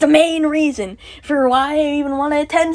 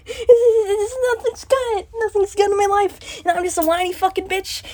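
high, crying voice breaking into words; real tearful outburst; 6 semitones higher than usual. Real, not acted. Venting that nothing makes life worth living, tearful. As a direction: wail it through rising tears.

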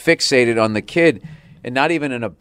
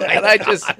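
ordinary talk, nothing marked

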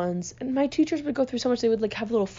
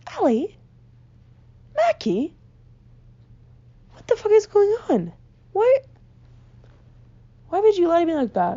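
high-pitched, exasperated disbelief with long pauses; mock betrayed indignation; 7 semitones higher, 4 dB louder than usual. Confronting sister who lied about hearing Santa, mock indignation. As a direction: confront them as if deeply wronged, pitch climbing.

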